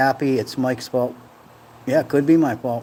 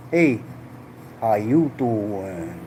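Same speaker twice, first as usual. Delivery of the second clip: very slow sitcom pickup line, sinking lower; Joey Tribbiani; 3 semitones lower, 4 dB quieter, much slower than usual. Acted. Streamer demonstrates the sitcom catchphrase, drawn-out come-on. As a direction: draw out each word like a flirt. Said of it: "Joey, Joey Tribbiani and friends".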